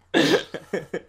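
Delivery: high, loud laugh. Real, not acted. Host cracks up at the answer, high loud laugh.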